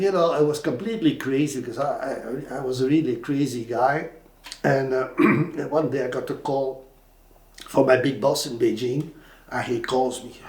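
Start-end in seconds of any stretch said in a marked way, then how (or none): none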